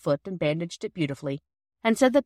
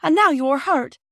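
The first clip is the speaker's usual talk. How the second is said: firm; 8 semitones higher, 7 dB louder, slower than usual. A narrator's reading. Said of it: "said Peter firmly".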